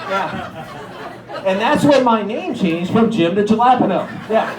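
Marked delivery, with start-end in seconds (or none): none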